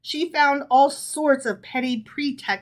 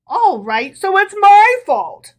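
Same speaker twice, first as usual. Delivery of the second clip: much higher, louder sarcastic jab; 10 semitones higher, 10 dB louder than usual. A narrator's reading. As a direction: throw it back mockingly. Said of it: "said the rabbi sarcastically".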